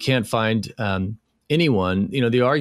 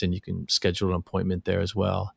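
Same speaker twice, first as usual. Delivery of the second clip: ordinary talk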